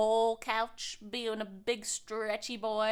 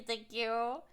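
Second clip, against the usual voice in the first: ordinary talk, nothing marked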